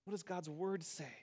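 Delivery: quiet repeated question. Shown as a demonstration. Preacher repeats the humble question quietly. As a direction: gently ask it again, leaning on does. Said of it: "humbly ask".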